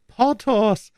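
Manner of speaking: high-pitched, indignant name-call; high voice of protest. A narrator's reading. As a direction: pull a friend up by name. Aramis starts an angry retort.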